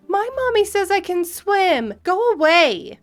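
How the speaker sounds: put-on high-pitched bratty child voice; entitled kid. Entitled kid defies the pool's owner, bratty. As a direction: whine it like a spoiled little kid. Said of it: "one entitled kid stays in".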